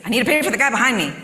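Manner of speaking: irritated loud repeat to the cashier; grumpy repeat. Retold or imitated. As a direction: snap it out, fed up at having to repeat it. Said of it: "And I'm just grumpy".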